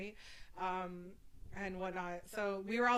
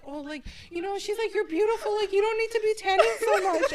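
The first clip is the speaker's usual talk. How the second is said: sweet lady; 9 semitones higher, 5 dB louder than usual. Retold or imitated.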